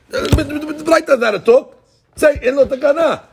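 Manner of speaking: high-pitched, argumentative protest; protesting objection. Acted. Teacher voicing the objection that the Braita doesn't talk so, protesting.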